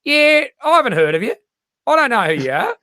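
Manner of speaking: high, offhand brush-off; mock-dismissive Australian; dismissive Aussie. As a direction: shrug them off as nobodies. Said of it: "Australian culture is kinda".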